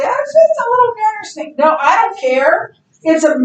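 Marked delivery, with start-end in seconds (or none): 0.0-1.6 much higher, sing-song brushing-off voice; mock dismissive people. Teacher mocking people who shrug off snakes, high sing-song. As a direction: mimic someone waving away a snake fear. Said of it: "People say".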